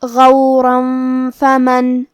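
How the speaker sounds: غورا فمن۔ with the tanween hidden in a nasal hum before ف; heavy غ, then hum into فمن۔. Shown as a demonstration. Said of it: "تنوین کو چھپاتے ہوئے اور غنہ کے ساتھ". (اخفاء، غنہ کے ساتھ)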